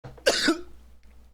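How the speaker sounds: harsh choking cough; real coughing fit after swallowing wrong; coughing up water. Real, not acted. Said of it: "My water went down the wrong pipe".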